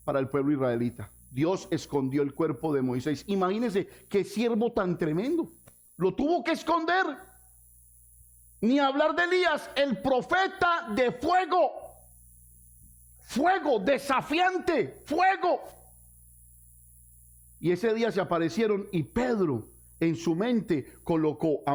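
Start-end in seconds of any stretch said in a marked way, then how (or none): 6.0-15.6 fervent preaching. Acted. Pastor praising Elijah to the church, fervent preaching. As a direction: hail the prophet of fire.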